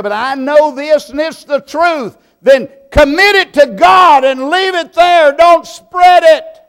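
high, loud pulpit warning; preaching at full force. Acted. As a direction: thunder it down at the gossipers.

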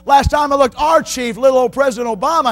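high, loud pulpit voice; impassioned preacher's shout; fiery preaching. Acted. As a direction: thunder the point home. Preaching at full force.

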